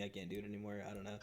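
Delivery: ordinary talk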